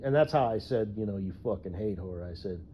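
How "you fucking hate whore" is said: calm, disgusted insult; quiet contemptuous jab; matter-of-fact disgust. Shown as a demonstration. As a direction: say it flat with disgust. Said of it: "kinda like disgust".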